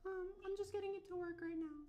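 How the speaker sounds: put-on high falsetto girl voice; man doing a woman's voice. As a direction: squeak it out like a girl. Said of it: "I might have to put on a girl voice".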